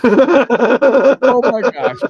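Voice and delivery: real laugh breaking the words; laughing exclamation. Real, not acted. Host cracking up at his own queso story, laughing.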